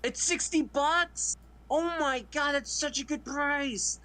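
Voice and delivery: high, thrilled gush; squealing at a bargain; excited. Real, not acted. Thrilled at a cheap 4K box set.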